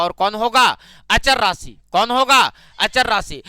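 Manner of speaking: punchy, loud question and answer; loud class drill. Real, not acted. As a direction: call it out for the whole class to chant. (कक्षा से ज़ोर से दोहरवाते हुए)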